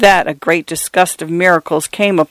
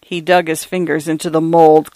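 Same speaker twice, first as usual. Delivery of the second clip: usual speaking voice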